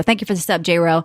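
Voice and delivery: ordinary talk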